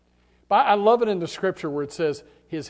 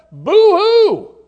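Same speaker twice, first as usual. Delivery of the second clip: high, loud mocking fake sob; mock boo-hoo; 14 dB louder than usual. Preacher mocking Elijah's self-pity, hammed-up boo-hoo. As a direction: ham up a whiny crybaby wail.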